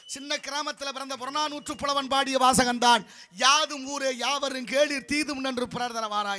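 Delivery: loud, ringing platform declamation; oratory. Acted. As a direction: proclaim it to the whole hall. Declaims Tamil verse to audience. (மேடைப் பேச்சு முழக்கம்)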